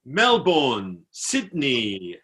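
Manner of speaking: put-on lightly accented Czech soldier listing names; imitating Czech soldier. Czech soldier shows off Australian cities, imitated accent. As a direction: recite each city separately, imitating a Czech. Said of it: "like that".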